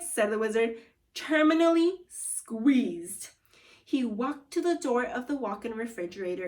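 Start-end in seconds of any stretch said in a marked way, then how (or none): 1.1-3.3 drawn-out punchline. Acted. Wizard deadpan punchline.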